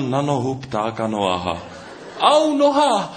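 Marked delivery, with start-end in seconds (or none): 2.2-3.1 very high, loud yelp of pain; pain yell. Acted. Bitten bird Noha yelling in pain, high loud yelp. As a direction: scream out as the snake bites. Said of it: "zařval bolestí Pták Noach".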